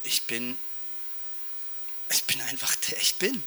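hushed and quiet, partly whispered; gentle quiet God. Retold or imitated. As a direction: say it softly, like a calm secret. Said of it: "ist er ganz anders gewesen".

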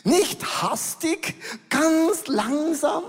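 high, slow, drawn out; slow and calm. Shown as a demonstration. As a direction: make them feel the slowness. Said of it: "Langsam ist die Betonung". (ruhig und gedehnt, hoch gesprochen)

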